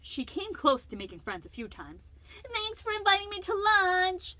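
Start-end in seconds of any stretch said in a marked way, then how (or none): 2.5-4.3 put-on high cheerful girl voice; sweetly grateful as Lucy; Lucy polite. Lucy thanking bees.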